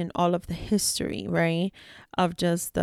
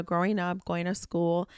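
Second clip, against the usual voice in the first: usual speaking voice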